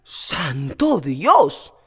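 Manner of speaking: slow, drawn-out horrified cry; a servant appalled at blasphemy; shocked near shout. Acted. Antoñona hearing Pepita's confession, horrified. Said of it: "gritó casi Antoñona".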